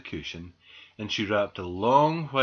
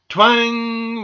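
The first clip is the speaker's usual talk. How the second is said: long, high imitation of a snapping aerial; loud ringing twang; 12 semitones higher, 13 dB louder than usual. A narrator's reading. Aerial breaks under squirrel, loud drawn-out twang. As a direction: stretch out the twang loudly.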